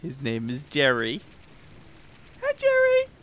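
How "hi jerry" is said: very high, loud falsetto hello; cutesy greeting. Pretend. Streamer greeting his new pet Jerry, cutesy squeal. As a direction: coo loudly as if to a pet.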